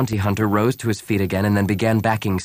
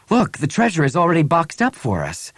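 excited, much higher voice; exclaiming; 9 semitones higher than usual. A narrator's reading. Bria spotting the boxed treasure, exclaiming. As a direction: point it out eagerly, pitch lifted. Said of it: "Bria exclaimed, pointing".

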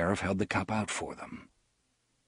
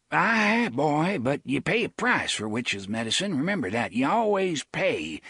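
blustering sheriff voice; 4 semitones higher than usual. Acted. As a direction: play the fussy rural sheriff. Avery voiced.

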